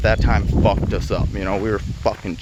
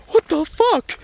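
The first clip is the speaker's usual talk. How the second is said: mock outraged squeal, much higher; pissed stepsister; 5 dB louder than usual. Stepsister gawking at him tearing the turkey, high mock outraged squeal. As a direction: shriek it like a shocked kid sister. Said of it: "all pissed".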